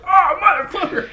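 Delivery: high yelled "Oh, motherfucker!"; shouted curse. Retold or imitated. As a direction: let the curse burst out in pain. Retold reaction after the slap.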